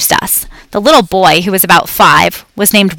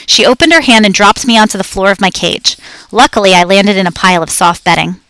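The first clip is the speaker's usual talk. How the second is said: ordinary delivery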